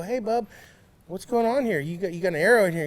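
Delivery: calling over to the rival, half-playful; retold call-out. Retelling how he called to the Traeger staffer, a mock loud hail. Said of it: "and I go".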